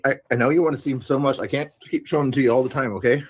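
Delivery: ordinary talk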